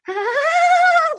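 high, drawn-out "ah"; mock dismayed cry. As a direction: imitate a roomful of women reacting in dismay. Women's dismayed reaction acted.

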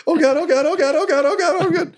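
very high, frantic squeak; terrified little goblin whimpering as it charges; panicked apologetic goblin. Acted. Goblin charging the barbarian against its will, frantic high squeal. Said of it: "in the most apologetic way".

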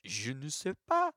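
put-on French mime dialect; French-accented interpreter for a mime. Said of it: "He's doing a French accent".

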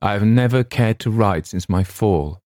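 usual speaking voice

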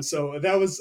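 ordinary talk, nothing marked

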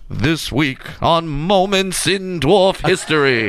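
slow show-intro announcer voice; sketch announcer. Pretend. Introducing the sketch Moments in Dwarf History, announcer style. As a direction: announce it like a TV segment opener. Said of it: "that's an S N L sketch".

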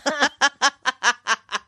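long burst of laughter; laughing. Real, not acted. Woman amused by her own story, long laughing fit. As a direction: crack up at your own story.

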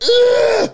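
loud high strained squeal; sudden high-pitched yelped groan; vocal tic demo. Shown as a demonstration. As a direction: let out a long, piercing tic noise. Demonstrating a long vocal tic.

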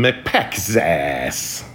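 "Mapekzas" extremely drawn out, syllable by syllable; sounding out a name. Shown as a demonstration. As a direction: stretch "Mapekzas" as far as it goes, puzzling it out. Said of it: "I say it different ways".

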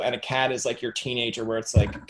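normal talking voice

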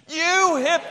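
cut-off yell, loud and high; full shout. Shown as a demonstration. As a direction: belt it as loud as you can.

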